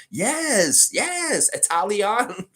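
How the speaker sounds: high, bright put-on Italian accent, Italian. landed with a fall; mock Italian accent. Answering chat's praise of his accent, hammy mock Italian accent. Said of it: "Your accent is improving".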